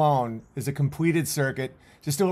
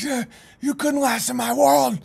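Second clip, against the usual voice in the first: higher mock taunt from Tyson; imitating Tyson taunting; 10 semitones higher than usual. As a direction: taunt like a fighter gloating, pitched up. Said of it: "He's like".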